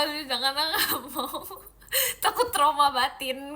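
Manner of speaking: slow, giggling no; drawn-out laughing protest; laughing refusal. Real, not acted. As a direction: laugh your way through it. Laughing while refusing prank.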